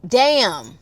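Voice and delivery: ordinary talk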